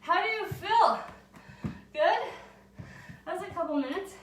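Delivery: winded after cardio, talking out of breath; out of breath, still recovering. Real, not acted. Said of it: "I just get to catch my breath here".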